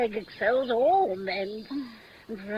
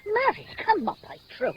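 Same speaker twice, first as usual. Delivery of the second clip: higher, indignant retort; 7 semitones higher than usual. Acted. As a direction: huff at her impatience. Nurse snapping back indignantly.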